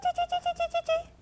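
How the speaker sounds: tiny very high squeaking; mouse squeak. Retold or imitated. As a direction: squeak like a dying little mouse. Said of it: "the poor little mouse was still going".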